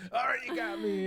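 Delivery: high, loud cry of surrender; mock confession. Comedian joking with border guards, mock confession. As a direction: give yourself up in a hammed-up high voice.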